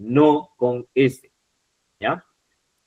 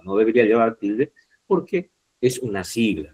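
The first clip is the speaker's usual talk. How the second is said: normal talking voice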